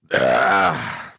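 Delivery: low grunt. Pretend.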